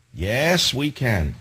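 ordinary talk

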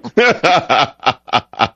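real laugh at a boast. Real, not acted. Host laughing at the caller's Texas boast, amused laugh.

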